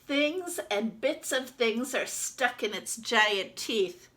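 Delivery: lively storybook reading as the duck; reading to children. Acted. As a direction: read it out to the class like a picture book. Teacher reads picture book aloud.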